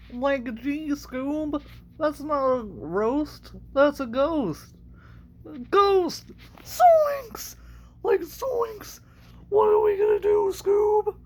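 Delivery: very high, cracking cartoon voice; high-pitched panic to a dog pal; Shaggy. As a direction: play the spooked cartoon sidekick. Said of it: "that was Shaggy and Scooby".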